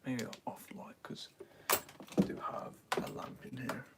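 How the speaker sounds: breathy hushed whisper. Real, not acted. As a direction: keep it to a whisper. Whispering to helper before stream.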